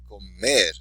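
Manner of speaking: Comer with the final "mer" stressed; stress on last syllable. Shown as a demonstration. Teacher modelling words for students, last syllable of Comer stressed. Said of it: "accent on the last syllable".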